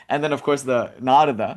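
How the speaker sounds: normal talking voice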